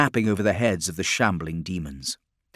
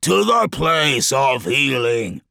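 loud, high, slow order; commanding; 6 semitones higher, 6 dB louder, slower than usual. A narrator's reading. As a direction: call it out slowly to a horde. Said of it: "he commanded".

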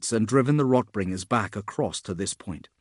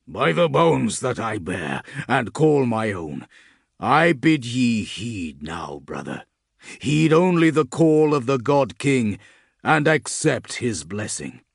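slow, rising battlefield prayer, building louder; solemn prayer; 5 dB louder than usual. Acted. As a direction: intone it like a last rite. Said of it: "It was a poor sort of prayer".

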